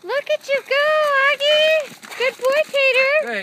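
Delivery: high, loud praise for the dogs; excited cheering. Real, not acted. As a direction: cheer them on, thrilled.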